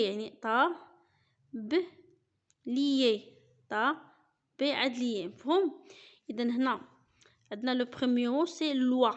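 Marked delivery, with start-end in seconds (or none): 0.0-5.3 \"طا، ب، ليي\" each syllable said apart with pauses. Shown as a demonstration. Teacher splitting tablier into syllables for the class. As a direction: spell tablier out in beats. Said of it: "ان طابليي".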